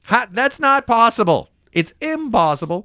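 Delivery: loud, high hammed-up disbelief; mock incredulous shouting. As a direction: shout it out, drawing out the protest. Continues the outburst he held back.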